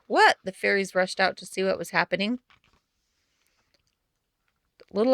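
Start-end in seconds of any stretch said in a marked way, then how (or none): 0.1-0.4 very high, loud startled cry; surprised. Acted. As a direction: jump at the sound. Fairies startled by the call.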